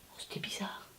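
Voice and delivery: hushed storyteller whisper. A narrator's reading. Narrator telling of the noise in the dark, whispering. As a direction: whisper it to build suspense.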